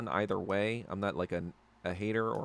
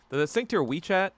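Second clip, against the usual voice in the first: usual speaking voice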